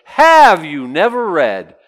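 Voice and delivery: slow, loud and cutting; scornful retort. Retold or imitated. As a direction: drag it out with scorn. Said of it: "he goes".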